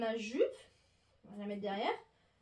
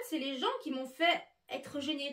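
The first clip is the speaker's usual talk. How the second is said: ordinary talk, nothing marked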